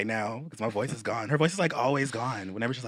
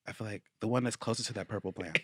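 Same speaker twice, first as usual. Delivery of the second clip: very quiet; 8 dB quieter than usual. Real, not acted. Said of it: "Is that the loudest you could?".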